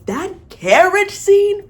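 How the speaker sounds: high, loud, slow gush; excited gush. Real, not acted. Fan announcing favourite scene, slow high excited gush. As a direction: savour the name like a treasure.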